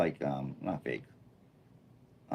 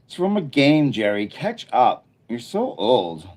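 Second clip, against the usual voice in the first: louder, higher teasing rebuke; mock scolding; 4 semitones higher, 6 dB louder than usual. Streamer voicing chat teasing Jerry about games, mock scolding. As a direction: rib him loudly for being out of touch.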